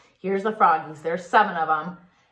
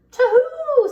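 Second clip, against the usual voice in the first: high, loud mock owl hoot; mother owl hooting to her babies; 11 dB louder than usual. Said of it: "said the mother".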